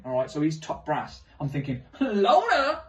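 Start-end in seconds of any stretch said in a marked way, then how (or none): 2.0-2.8 high, loud gleeful yelp with a laugh; excited squeal. Retold or imitated. Comic exclamation of awe.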